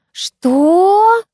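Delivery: high, loud, stretched disbelief; drawn-out squeal of disbelief; drawn-out shocked what. A narrator's reading. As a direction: stretch the word upward in amazement. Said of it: "протянули все снова хором".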